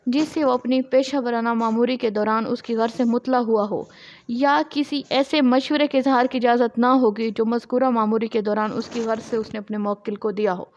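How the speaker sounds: ordinary talk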